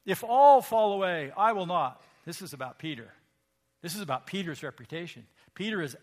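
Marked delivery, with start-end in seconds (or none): 0.1-2.0 loud, insistent declaration; declared. Acted. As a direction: proclaim it like a proud vow. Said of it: "Peter declared".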